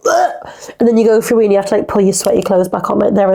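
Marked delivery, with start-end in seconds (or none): 0.1-0.7 high breathy whispered groan of disgust; disgusted ugh. Retold or imitated. Feeling sweat trickle down her back in Florida, groaning in disgust. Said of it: "and you're just like".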